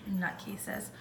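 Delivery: unvoiced fond mutter; whispered aside. Real, not acted. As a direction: breathe the word out under your breath. Muttered about the dogs.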